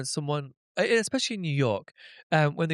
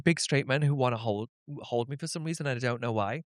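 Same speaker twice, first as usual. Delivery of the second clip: ordinary talk, nothing marked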